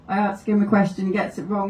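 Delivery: ordinary delivery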